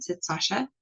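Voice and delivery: usual speaking voice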